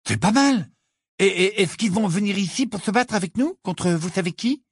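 high, eager voice for Ron; enthusiastic. A narrator's reading. Ron asks Hagrid about giant allies, enthusiastic. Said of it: "dit Ron avec enthousiasme".

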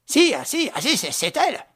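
high, breathless stammering; frightened stammer. Acted. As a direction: trip over the words in fear. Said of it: "répéta la mère Vasseur".

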